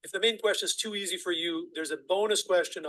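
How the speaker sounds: ordinary talk, nothing marked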